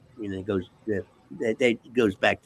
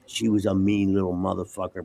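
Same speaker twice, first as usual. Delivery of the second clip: normal talking voice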